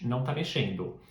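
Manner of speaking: ordinary talk, nothing marked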